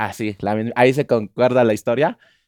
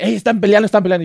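excited wake-up call; 7 semitones higher, 4 dB louder, much faster than usual. Retold or imitated. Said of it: "me levanto y me dice".

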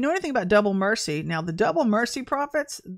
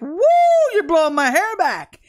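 excited crowd; 9 semitones higher, 8 dB louder than usual. Retold or imitated. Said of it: "everybody's like".

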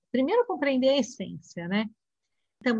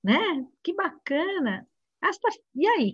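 bright, higher, friendly chit-chat with students; cheerful teacher greeting; 7 semitones higher than usual. Retold or imitated. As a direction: greet the class like you're glad to see them. (professora animada cumprimentando alunos)

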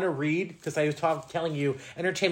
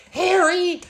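high, loud mock shriek; mock scream of Harry; 6 dB louder than usual. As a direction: scream her husband's name in alarm. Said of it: "she's like".

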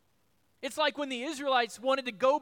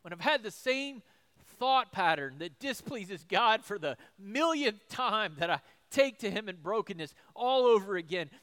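ordinary talk, nothing marked

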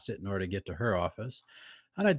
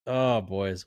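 ordinary delivery

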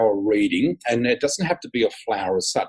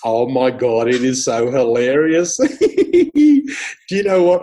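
amused laughter; 6 dB louder than usual. Real, not acted. Guest finds host's question about people's reactions hilarious, laughing. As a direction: crack up as you answer.

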